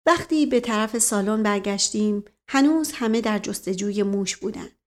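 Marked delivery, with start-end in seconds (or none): none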